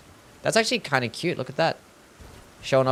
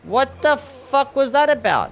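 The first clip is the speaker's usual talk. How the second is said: high, slow, stunned "what the hell"; baffled outburst; 11 semitones higher, 6 dB louder, slower than usual. Real, not acted. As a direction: drag it out in disbelief, pitched way up. Bewildered at the game character.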